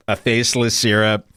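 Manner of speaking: ordinary delivery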